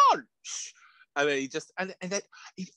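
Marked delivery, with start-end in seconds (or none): 0.1-0.7 food guy voice. Retold or imitated. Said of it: "the fat guy who's like".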